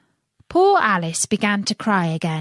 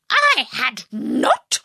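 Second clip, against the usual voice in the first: higher, slow, cutting denial; sharp angry retort; 5 semitones higher, much slower than usual. Acted. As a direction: snap it back, offended and angry. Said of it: "cried the Mouse sharply and very angrily".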